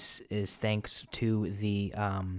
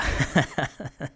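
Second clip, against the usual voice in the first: high, quick giggle; laughing; 5 semitones higher than usual. Real, not acted. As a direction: crack up at your own silliness. Laughs again at himself.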